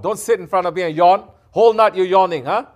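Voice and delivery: loud, high, sharp rebuke; furious teacher scolding a yawning student; angry scolding. Real, not acted. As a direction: call out the yawner, really angry. Scolds yawning listener during sohbat.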